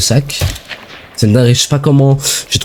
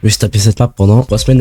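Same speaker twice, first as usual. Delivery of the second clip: ordinary talk, nothing marked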